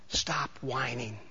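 soft, low and slow, almost under the breath; quiet, deliberate warning; hushed. Shown as a demonstration. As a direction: drop the voice way down and draw it out. First quiet take of the slogan.

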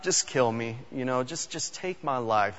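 a touch of self-pity, trailing down; moping. Retold or imitated. Preacher voicing Elijah begging God to take his life, softly moping. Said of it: "he starts moping".

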